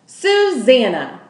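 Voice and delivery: loud, slow mocking shout of the name. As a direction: bellow the name in disbelief. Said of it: "shouted Lolly".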